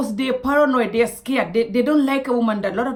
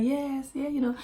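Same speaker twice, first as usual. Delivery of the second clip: soft, higher-pitched polite reply; small, careful voice; responding slowly; 4 semitones higher, 5 dB quieter than usual. Retold or imitated. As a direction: imitate a hushed, measured answer. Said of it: "I was responding slowly".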